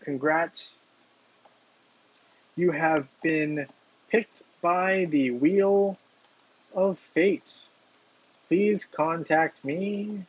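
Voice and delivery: slow, drawn-out dictating while typing; slow dictation. Real, not acted. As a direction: spell it out word by word. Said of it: "reads out comment while typing".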